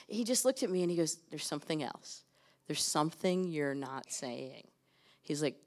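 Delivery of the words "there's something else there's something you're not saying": quiet probing. Retold or imitated. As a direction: lean in and press gently, much softer. Intuitive mentor probing quietly.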